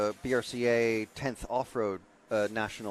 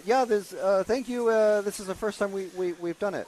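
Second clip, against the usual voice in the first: retold reply, higher and louder; quoting club people; 9 semitones higher, 4 dB louder than usual. Pundit retelling what club people said, lifted voice. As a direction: relay their answer in a lifted, eager voice. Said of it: "but they said".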